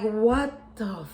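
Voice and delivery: drawn-out, stunned exclamation; disbelief. Retold or imitated. Woman finding her car keyed, in stunned disbelief. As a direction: let it out slowly, incredulous. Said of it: "sitting there in disbelief".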